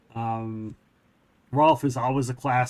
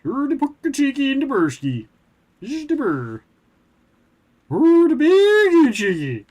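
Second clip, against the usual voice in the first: high mock Swedish Chef voice, louder at the end; 11 semitones higher, 7 dB louder than usual. Streamer repeating Swedish Chef bit for chat, hammed-up. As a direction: ham up the Muppet chef again. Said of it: "my Swedish Chef impersonation".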